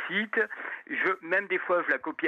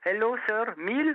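high, eager tourist-hustler greeting; local girl; 7 semitones higher than usual. Retold or imitated. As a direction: call out like a hopeful street seller. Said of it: "qui te dit".

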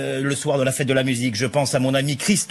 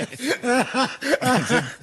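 laughing; 8 semitones higher than usual. Real, not acted. Laughs after joke.

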